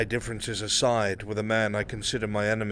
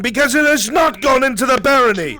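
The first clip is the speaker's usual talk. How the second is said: building from sharp questions to a full, much higher shout; furious accusation; 11 dB louder than usual. Acted. Noble berating the nobles across the table, in a rising furious shout. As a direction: rage at the idle nobles, louder with every line.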